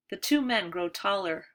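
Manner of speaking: plain reading voice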